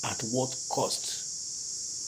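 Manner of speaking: slow, soft whisper; slow hushed whisper of a second inner voice. A narrator's reading. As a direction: breathe it out slowly in a whisper. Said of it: "another voice whispered".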